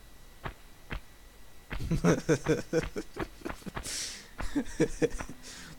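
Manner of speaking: wheezy, breathy chuckle; laughing. Real, not acted. Streamer cracking up on stream, laughing. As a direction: burst out in a long chuckle.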